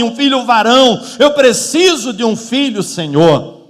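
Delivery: loud, building plea to God; preacher's impassioned acting of a desperate prayer; crying out in prayer. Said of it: "ela está ali orando e clamando".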